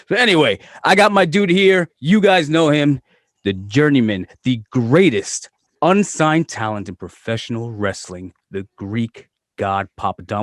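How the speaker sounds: big, loud ring-announcer build-up; hype announcer intro. Acted. Host hyping his guest to the listeners, loud announcer intro. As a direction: sell the guest like a wrestling entrance.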